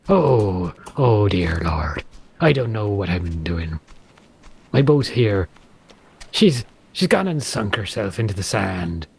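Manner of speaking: low, drawn-out West Country pirate. Acted. Pirate finding the boat sunk in sand, low pirate drawl. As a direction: lament like an old salt in Bristolian.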